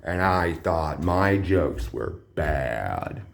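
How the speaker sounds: low, very slow Joker growl; menacing villain drawl, deep and slow; Joker impression. Shown as a demonstration. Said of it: "I guess I could do that voice if I wanted".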